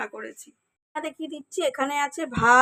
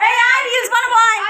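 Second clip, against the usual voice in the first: high, loud shouted call; 11 semitones higher, 7 dB louder than usual. Real, not acted. Calling kids to make reels.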